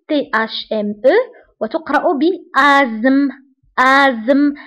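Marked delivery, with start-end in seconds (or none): 2.1-4.5 ازم said as "azm", no t or h, slowly; silent th. Shown as a demonstration. Teacher showing class the silent letters, slow. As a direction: asthme pronounced azm, stretched out. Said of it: "هذه التي اش لا ننطقها".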